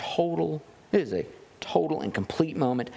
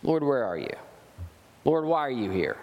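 whiny plea to the Lord; mild mock complaint, voiced as the people; complaining; 2 semitones lower than usual. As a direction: grumble it like a doubting Israelite. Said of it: "Start to complain".

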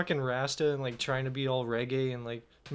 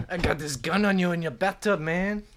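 mock-threatening Mexican accent; 2 semitones higher than usual. Shown as a demonstration. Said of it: "Do a Mexican accent".

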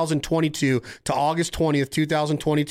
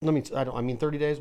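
ordinary talk, nothing marked

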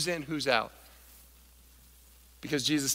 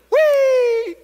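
gleeful whee; 11 dB louder than usual. Acted. Zacchaeus jumping down from tree.

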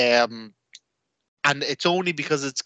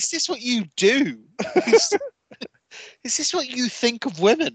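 high-pitched mock indignation; accusing girlfriend; 9 semitones higher than usual. Girlfriend confronting fan about wrestling, indignant accusation. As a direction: demand an answer, imitating outrage. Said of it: "You're like".

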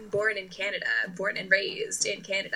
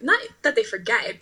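normal talking voice

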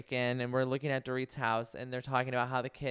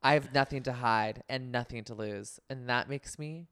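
menacing tagline. Acted. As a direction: build to a hushed threat on dangerous. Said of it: "I'm gonna do Erica".

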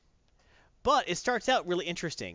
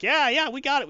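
mock eager agreement; 10 semitones higher, 6 dB louder than usual. Henchmen reply to Namor.

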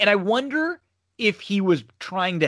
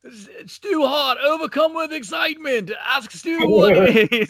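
much higher, put-on pompous announcer; pompous announcer brushing off the interview, higher voice; mock announcer; 8 semitones higher, 3 dB louder than usual. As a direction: rush through it like a stuffy commentator. Quoting Alfred cutting Stu off.

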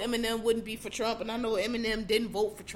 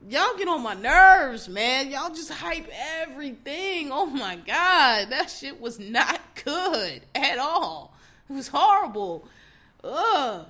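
exasperated rant; 3 semitones higher, 5 dB louder, slower than usual. Real, not acted.

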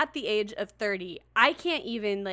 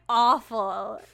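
normal talking voice

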